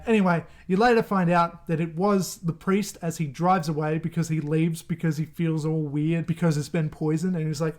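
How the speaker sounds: usual speaking voice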